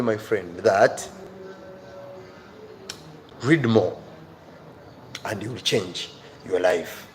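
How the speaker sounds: ordinary talk, nothing marked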